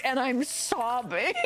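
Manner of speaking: ordinary talk, nothing marked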